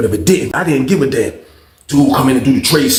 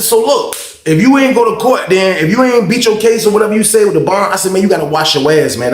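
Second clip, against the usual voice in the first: raised, high, forceful scolding; heated confrontation; 9 semitones higher, 6 dB louder than usual. Retold or imitated. Inmate tells cellmate to wash, heated confrontation. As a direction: lay into him, loud and high.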